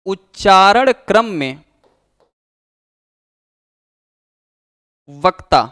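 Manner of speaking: teacher dictating notes, one phrase at a time; dictation pace. Shown as a demonstration. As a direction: read it out phrase by phrase for students to write down. Dictating the definition of Sangam.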